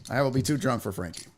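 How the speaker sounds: ordinary talk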